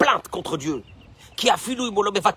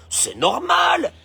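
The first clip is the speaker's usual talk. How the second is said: entitled shrug; 7 semitones higher, much slower than usual. Pretend. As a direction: stretch it out like it's obvious. Voicing entitled mindset.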